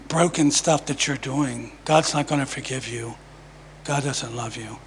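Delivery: a hushed, low tempter's voice fading nearly to nothing; accusing enemy voice. Retold or imitated.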